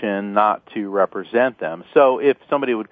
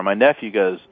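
ordinary delivery

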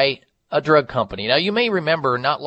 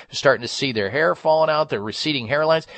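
usual speaking voice

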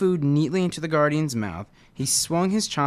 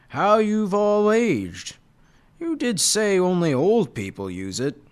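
put-on gloating old tiger; 7 semitones higher, 3 dB louder than usual. Tiger gloats over others' aging.

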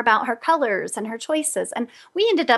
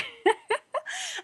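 high giggle; 7 dB quieter than usual. Real, not acted. Laughing at her own story.

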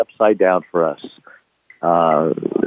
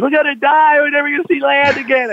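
high, louder mock panic; freaking out; 3 dB louder than usual. Panicked crewman sure the boat will sink, high wail. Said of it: "one guy kind of freaks out".

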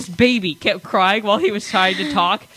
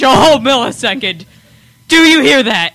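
sarcastic; 3 semitones higher, 10 dB louder than usual. Acted. Mocking tiny speed claim.

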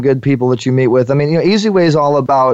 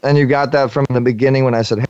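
ordinary talk

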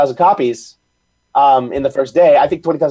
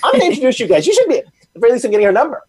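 excited friend matchmaking; 8 semitones higher than usual. Retold or imitated. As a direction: gush like a friend setting up a date. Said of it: "But if I'm friends with her and say".

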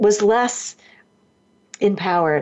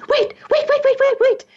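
rapid-fire high-pitched plea to stop; re-enacted panicky high call; urgent calling out. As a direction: call out frantically to halt them. Calling out while running down aisle.

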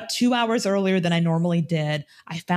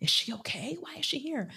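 high, hushed murmur of coworkers; hushed gossip; 10 semitones higher, 8 dB quieter than usual. Retold or imitated. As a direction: wonder aloud behind her back. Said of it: "every head just went like".

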